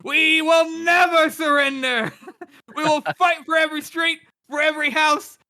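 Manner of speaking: high, loud, slow battle cry; rousing shout. Acted. King's climactic battle cry to the crowd, high loud shout. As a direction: whip the crowd up.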